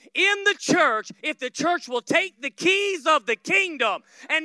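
loud, slowing, rising declaration; full-force preaching. Acted. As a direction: hammer each clause home. Preaching climax.

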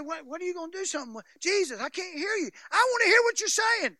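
a touch of frantic pleading; mock frustrated plea. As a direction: act out Hagin begging to hear.

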